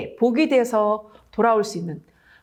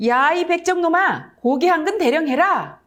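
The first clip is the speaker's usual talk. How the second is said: higher, louder rough order to the butcher; rough rude nobleman; 7 semitones higher, 4 dB louder than usual. Acted. First nobleman rudely ordering the old butcher, rough and loud. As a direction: bark it down at a lowly butcher. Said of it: "거친 말로 말했다".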